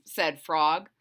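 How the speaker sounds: ordinary delivery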